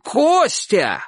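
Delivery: high, elated call; rapturous shout. A narrator's reading. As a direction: cry out the name in delight from the roof. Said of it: "восторженно закричал человек с крыши". (восторженный крик)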